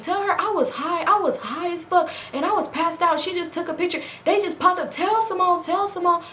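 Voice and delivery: high, pleading excuses; pleading boyfriend. Retold or imitated. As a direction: beg her to believe it.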